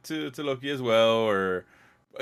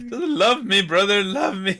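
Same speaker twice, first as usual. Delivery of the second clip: high, whining little brother; mock whiny Loki; 7 semitones higher, 3 dB louder than usual. Friend mocking Loki's jealousy, high whine.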